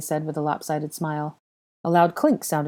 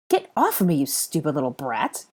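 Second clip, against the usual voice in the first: 1 harsh order. A narrator's reading. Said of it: "he ordered".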